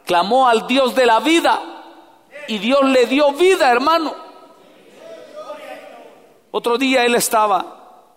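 loud, high preacher cry; preaching at full force. Acted. Preacher urging the congregation to cry out to God, loud and high. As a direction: lift it into a pleading call.

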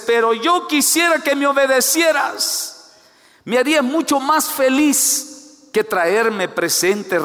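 loud, high-pitched father's plea; impassioned outcry. Acted. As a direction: cry it out at full preaching force. Father's inner plea, preached at full force.

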